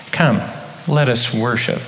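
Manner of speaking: usual speaking voice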